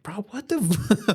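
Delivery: high, slow, laughing what the; cracking up in disbelief at the jail news. Retold or imitated. Driver reacting to passenger's jail story, laughing in disbelief.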